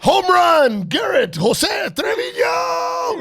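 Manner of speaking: loud, high, booming ballpark announcer; baseball announcer voice. Retold or imitated. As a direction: roar the lineup like a stadium announcer. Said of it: "that sounds like an announcer".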